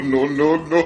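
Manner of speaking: ordinary delivery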